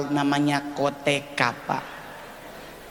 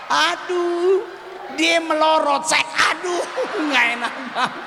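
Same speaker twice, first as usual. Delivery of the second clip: embarrassed comic outburst; 10 semitones higher than usual. Acted. Comedian tells audience of his koteka mishap, panicked high wailing. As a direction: yelp each aduh as if mortified.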